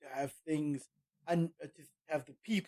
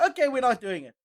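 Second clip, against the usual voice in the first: higher, louder "okay" that slides down; mock quick concession; 6 semitones higher, 7 dB louder than usual. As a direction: give in at once as the imagined company. Company giving in at once, acted.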